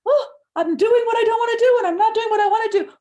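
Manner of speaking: high, loud, fast exasperated outburst; anguished Paul. Retold or imitated. Paul wrestling with himself in Romans, high loud anguished cry. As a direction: blurt out the struggle in frustration. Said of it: "Paul wrestled with in Romans seven when he said".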